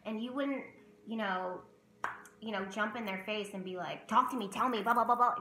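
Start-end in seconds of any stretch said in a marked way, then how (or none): 4.1-5.3 loud, pushy demanding; mock rude badgering. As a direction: get in their face, rattle it off loudly. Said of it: "That's rude".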